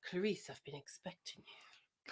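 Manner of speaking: soft unvoiced villain whisper; creepy whisper. Friend whispers the Lecter line on cue. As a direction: breathe it out menacingly. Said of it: "Insert acting".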